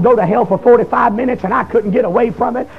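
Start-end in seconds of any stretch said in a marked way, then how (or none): none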